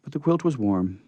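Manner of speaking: usual speaking voice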